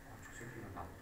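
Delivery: low, very quiet acted mutter; hushed, low private thought; jealous inner thought. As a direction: grumble it under your breath. Voices a jealous boyfriend's inner thought.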